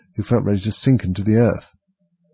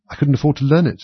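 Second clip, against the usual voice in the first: higher-pitched wistful admission; sighing; 7 semitones higher, 4 dB louder than usual. A narrator's reading. Mock Turtle tells Alice he missed out, sighing. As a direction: confess it with a sigh. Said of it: "said the Mock Turtle with a sigh".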